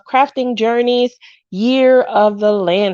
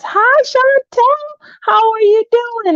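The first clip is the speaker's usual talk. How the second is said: much higher, slow, sing-song hello; sweet high greeting; 13 semitones higher, slower than usual. Real, not acted. Greeting a viewer named in the live chat, high and sing-song.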